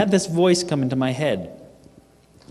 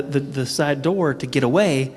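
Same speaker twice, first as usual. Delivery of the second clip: normal talking voice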